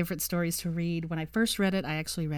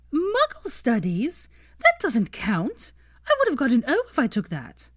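indignant protest; 8 semitones higher, 5 dB louder than usual. Acted. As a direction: refuse to accept it. Hermione protests the wager.

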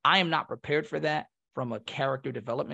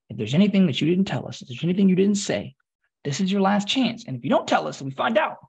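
loud, raised official warning, retold; stern warning; 5 semitones higher, 6 dB louder than usual. Processing staff give recruits a last chance, stern. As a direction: press each condition home, louder and higher.

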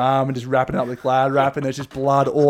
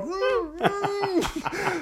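muffled mm; 6 dB quieter than usual. Retold or imitated. Mate holding in vomit.

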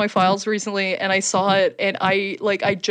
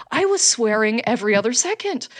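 ordinary delivery

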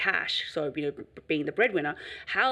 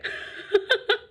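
breathy laugh. Real, not acted.

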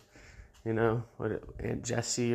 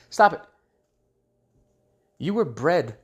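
much higher, louder stop it; scolding shout; 5 semitones higher, 8 dB louder than usual. Acted. Comic tells pious athletes to quit, with a scolding shout. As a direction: snap it out like a scolding order.

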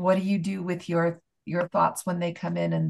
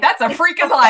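loud, higher-pitched mock outburst; retold shouted retort; 8 semitones higher, 9 dB louder than usual. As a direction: echo the friend's shout with gusto. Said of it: "I think I shouted at you".

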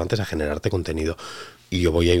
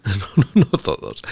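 laughing; 3 semitones higher, 4 dB louder, slower than usual. Real, not acted. Laughs while backtracking.

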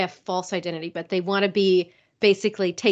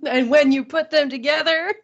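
laughing; 5 semitones higher, 4 dB louder than usual. Real, not acted. Cracking up at co-host's kink summary.

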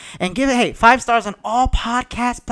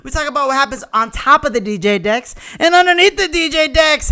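loud pumped-up show opener; hype host intro; 7 semitones higher, 4 dB louder than usual. Acted. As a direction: shout it like a party host.

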